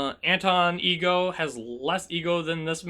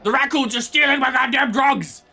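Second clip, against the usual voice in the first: mock outraged character; 4 semitones higher than usual. Streamer imitating a companion whose drugs raccoons steal, mock outrage. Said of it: "I literally thought that he's gonna say".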